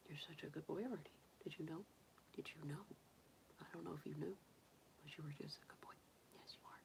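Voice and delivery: tender whisper. Real, not acted. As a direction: whisper sweet praise close to a pet. Whispering praise to pet bunny.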